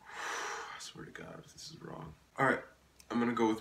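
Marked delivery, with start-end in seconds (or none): 0.0-2.1 breathless whisper, much lower and softer; whispered resigned I swear to God; sighing whisper. Real, not acted. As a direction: sigh and whisper it. Resigned about answer.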